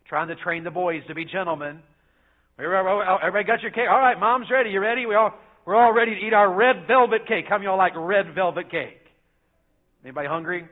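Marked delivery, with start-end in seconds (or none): 2.6-10.7 fast, loud, eager rallying of the family; cheerful dad herding the table; excited table call. Retold or imitated. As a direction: hurry everyone to their cake. Voicing himself at table, excited.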